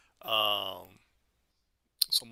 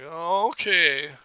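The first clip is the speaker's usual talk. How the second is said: hesitant; 6 semitones higher, 4 dB louder, much slower than usual. Retold or imitated. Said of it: "it's like".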